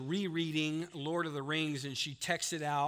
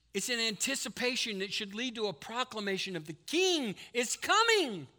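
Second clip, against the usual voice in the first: urgent preaching; 6 semitones higher than usual. Real, not acted. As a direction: press it home, climbing to the last words. Sermon rises to urgent appeal.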